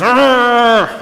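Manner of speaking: loud, high mock roar; bear growl. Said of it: "and the bear says".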